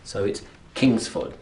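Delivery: Kingsford with KINGS strong and Ford weak; stress on KINGS. Shown as a demonstration. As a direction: hit the first syllable of Kingsford. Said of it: "is the strong one".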